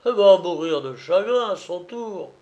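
outraged drum major, loud at the outset; angry accusation. Acted. Major threatening the false old comrade, angry and forceful. Major furious at being fooled.

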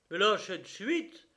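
high, loud angry order; enraged yell. A narrator's reading. The brigadier, gripped by the elbow, orders release with an enraged yell. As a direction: yell it with rage. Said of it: "hurla le brigadier avec rage".

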